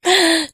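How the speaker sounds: a short, high, loud teasing laugh. Acted. Mistress laughing at her pet, a short teasing laugh. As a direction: laugh down at him, amused. Teasing dominant over submissive partner.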